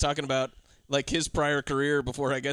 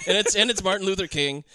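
normal talking voice

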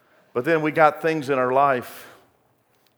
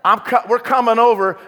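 mock panic; 8 semitones higher, 7 dB louder than usual. Panicked repeat of the phone call.

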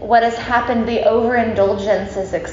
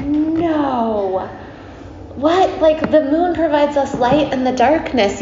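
mock dismayed protest; 5 semitones higher, slower than usual. Devotees protest losing the moon.

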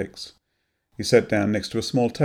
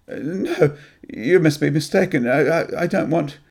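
high, stammering refusal; backing away from a painful past; panicked denial; 7 semitones higher, 4 dB louder than usual. Acted. Graham refuses to believe Anne, panicked stammer. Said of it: "panic swelling within him".

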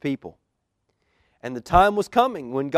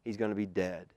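usual speaking voice

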